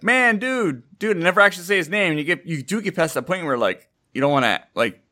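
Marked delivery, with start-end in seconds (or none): 0.0-1.2 casual greeting. Retold or imitated. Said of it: "And I'm like".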